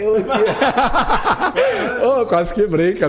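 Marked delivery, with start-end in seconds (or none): 0.2-2.2 high, loud teasing cackle of teammates; imitated mocking laughter. Retelling teammates mocking him on the bus, high mocking laugh. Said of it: "Os caras rindo".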